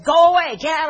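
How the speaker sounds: high, loud frightened shout; panicked yell. Retold or imitated. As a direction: scream at him to go away. Woman yells at stranger at car.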